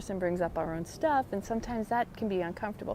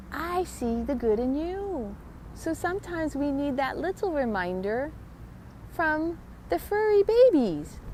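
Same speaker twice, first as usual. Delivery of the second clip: tender pet voice; 6 semitones higher than usual. Pretend. Voicing the pet's message.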